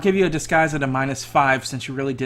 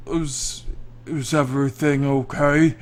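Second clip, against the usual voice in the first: booming giant voice; magically amplified voice. Acted. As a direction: call out like a huge giant from afar. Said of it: "hear this booming voice".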